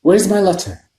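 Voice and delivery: Harry confronts his uncle; demanding boy. A narrator's reading. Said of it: "said Harry".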